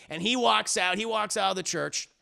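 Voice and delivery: ordinary delivery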